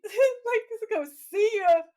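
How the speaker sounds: high-pitched, giggly retelling; cracking up mid-quote; laughing while talking. Real, not acted. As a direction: barely get the quote out for laughing.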